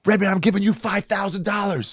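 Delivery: very high-pitched imitation of an elated winner; overjoyed generosity. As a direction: announce the gift in a giddy high voice. Winner shares prize, retold.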